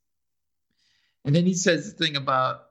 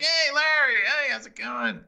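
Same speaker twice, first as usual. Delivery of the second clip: high-pitched hail, imitating a friend; acted-out cheery hello; mock hearty greeting; 9 semitones higher than usual. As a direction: call out a greeting in a raised voice. Voicing a man hailing Larry.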